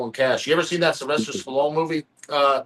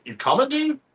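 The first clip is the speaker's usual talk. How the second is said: dude pulled up high; a sing-song high you coming dude; high mock call; 11 semitones higher than usual. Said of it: "I was texting him, like".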